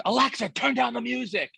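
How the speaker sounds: lightly hammed-up mom shouting at the device; mock mom yelling. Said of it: "she just screams".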